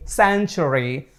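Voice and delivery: century said slow and soft as sænchuri; careful slow model of century; model pronunciation. Shown as a demonstration. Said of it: "So repeat after me".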